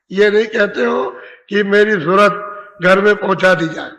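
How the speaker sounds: ordinary talk, nothing marked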